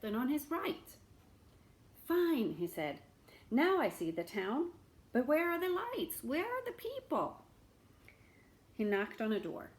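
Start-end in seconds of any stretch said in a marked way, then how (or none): 2.1-2.5 higher, louder beggar exclaiming; lightly voiced beggar; beggar's voice. Beggar reaching the dark town, mild exclaim. 3.5-7.4 higher, puzzled questions; a touch of bewildered beggar. Acted. Beggar asking where the townspeople are, mildly puzzled.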